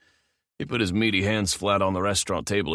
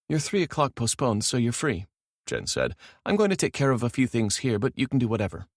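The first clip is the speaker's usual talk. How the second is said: higher voiced young woman; female assistant voice; 6 semitones higher than usual. A narrator's reading. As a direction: play the assistant, a touch apologetic.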